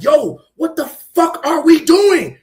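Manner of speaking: slow, loud, exasperated yell; loud, drawn-out retold yo what the fuck; retold outburst. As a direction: shout your own angry message back at the group chat. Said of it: "I'm like".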